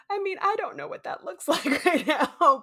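loud, high laugh-crying; laughing through tears. Retold or imitated. Answering the receptionist's offer of comfort, laughing through tears. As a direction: laugh while still crying.

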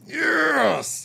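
high, drawn-out yes; retold excitement. Acts out own thrilled reaction.

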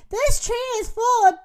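high, loud warning; alarmed shout. Acted. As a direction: cry out the alarm. Turkeys raise the alarm.

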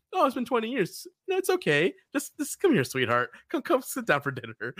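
high, fussing hostess voice; hospitable wife. Acted. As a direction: coax a guest to the table like a doting hostess. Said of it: "the most hospitable woman ever".

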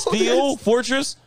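high drawn-out disbelieving question; incredulous. Real, not acted. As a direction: stretch the name in disbelief. Incredulous repeat of name.

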